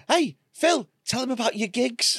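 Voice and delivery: high-pitched imitation of a friend butting in; mock-innocent high prompt; teasing friend. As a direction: pipe up high to egg him on. Imitates Becca chipping in to tease.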